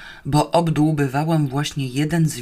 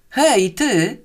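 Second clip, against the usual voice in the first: higher, good-natured call; friendly yell; 8 semitones higher, 4 dB louder than usual. A narrator's reading. Prisoner hailing the watchman to win sympathy, friendly yell. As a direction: call out high, meaning well. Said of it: "wrzasnęłam życzliwie".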